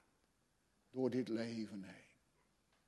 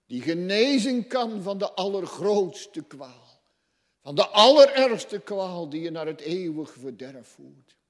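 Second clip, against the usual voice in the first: higher, louder warning; preaching at full force; 7 semitones higher, 16 dB louder than usual. Acted. Preacher warning the unconverted, loud and high. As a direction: thunder the warning from the pulpit.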